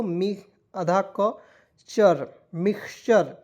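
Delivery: half-speed sounding out of mixture; teacher spelling out mixture; slow syllable breakdown. Shown as a demonstration. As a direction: break the word into parts slowly. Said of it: "syllable breakdown".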